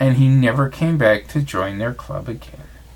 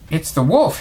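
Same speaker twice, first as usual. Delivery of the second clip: ordinary delivery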